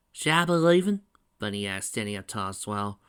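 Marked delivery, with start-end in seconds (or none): 0.1-1.0 high, loud female character; pitched-up, rising question; Bunny's voice. A narrator's reading. Bunny offers to leave father and daughter, questioning.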